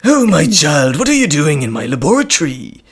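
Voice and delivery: loud theatrical mad scientist; hammed-up, booming villain voice; Doctor Hell voice. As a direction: scold an intruder with villainous relish. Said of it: "If we combine those voices".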